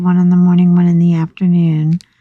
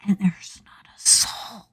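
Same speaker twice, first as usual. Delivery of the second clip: whispering; 12 dB quieter than usual. Retold or imitated. Retelling eerie empty hospital.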